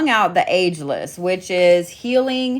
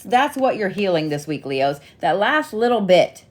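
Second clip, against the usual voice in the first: usual speaking voice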